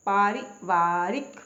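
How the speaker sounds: पारिवारिक slowly in two chunks, paa-ri, vaa-rik; careful model pronunciation. Shown as a demonstration. Teacher modelling spelling, पारिवारिक slow with long first aa. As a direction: stretch the long aa's in पारिवारिक. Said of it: "पारिवारिक नहीं होता".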